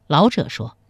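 ordinary delivery, nothing marked

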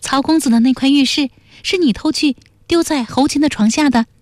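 high-pitched, pressing character voice; high and insistent accusation; accusing young questioner. A narrator's reading. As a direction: confront him in a high, level voice. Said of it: "半儿盯着他问道".

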